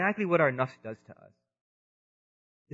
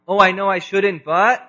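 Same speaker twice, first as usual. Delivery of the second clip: mock guilty giving in, a bit louder and higher; tempted diner; 5 semitones higher, 8 dB louder than usual. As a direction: play the diner caving to the dessert cart. Diner tempted by dessert cart.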